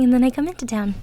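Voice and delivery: normal talking voice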